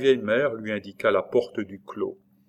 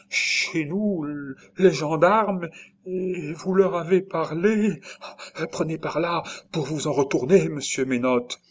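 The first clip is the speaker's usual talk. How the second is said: aghast alarm; 8 semitones higher, 3 dB louder than usual. A narrator's reading. Nurse sending him away from gendarmes, high aghast voice. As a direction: stammer in shock, then urge him away. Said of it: "resta bouche béante".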